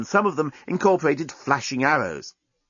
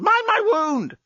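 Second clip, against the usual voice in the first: yelled; slower than usual. Acted. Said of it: "the journalist yelled".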